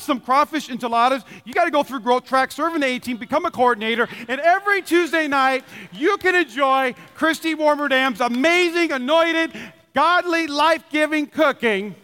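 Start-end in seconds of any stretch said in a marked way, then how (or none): 5.9-11.9 mounting praise. Real, not acted. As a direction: heap it on like a hype man. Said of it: "building praise".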